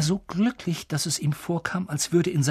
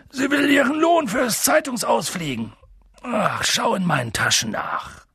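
Hagrid, sleepy grumbling; 9 semitones higher, 5 dB louder than usual. Acted. Sleepy giant explaining the owl's wage, grumbling. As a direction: grumble it out half asleep. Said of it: "grunzte Hagrid in das Sofa".

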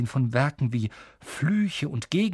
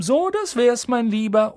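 high, louder shopkeeper's sing-out; 9 semitones higher, 6 dB louder than usual. A narrator's reading. Shopkeeper telling Harry the fitting is done, high-voiced. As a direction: call it out high to the customer. Madame Malkin finishes serving Harry.

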